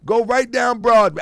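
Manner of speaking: usual speaking voice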